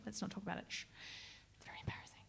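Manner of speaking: hush, then whisper it; conspiratorial whisper. Real, not acted. Singer to audience, a joking whispered confession. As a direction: whisper it like a guilty secret to the crowd. Hushing talk of Brexit.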